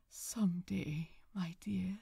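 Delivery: soft, tender murmur. A narrator's reading. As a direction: murmur it gently to a sleeping lover. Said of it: "he murmured".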